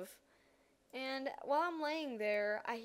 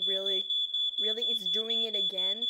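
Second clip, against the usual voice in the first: annoyed; 2 semitones lower, 7 dB louder than usual. Retold or imitated. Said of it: "and I'm just like".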